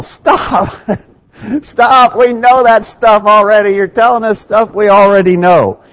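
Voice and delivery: high-pitched mock protest; mock yell. Preacher voicing listeners who know it already, mock yell. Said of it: "before you yell".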